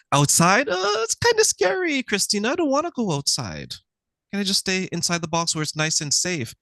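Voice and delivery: high, mock-frightened pleading voice; mock scared. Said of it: "they're like, "Ooh, that's scary".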